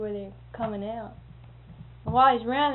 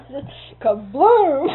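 a slow, loud "kabloom" that lands in a laugh; 4 semitones higher, 14 dB louder, much slower than usual. Retold or imitated. Retelling the exploding switch box, slow loud kabloom. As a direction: blow the word up like the box exploding.

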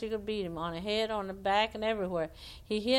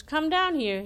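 calling up; 8 semitones higher, 6 dB louder than usual. Acted. Rabbit calls squirrel down again.

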